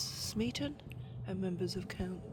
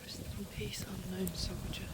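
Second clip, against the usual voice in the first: whisper; 8 dB quieter than usual. Real, not acted. Paying respects at unknown soldier's tomb.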